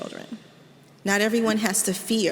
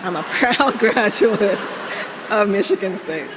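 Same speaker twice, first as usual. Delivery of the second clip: laughing through it; 5 dB louder than usual. Real, not acted. Speaker repeating the Michigan State dig to the audience, laughing. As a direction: savour the joke with a real laugh. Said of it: "that was my dig".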